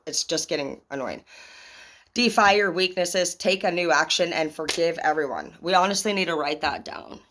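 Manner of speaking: ordinary talk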